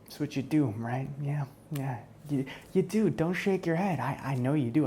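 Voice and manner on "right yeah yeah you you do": usual speaking voice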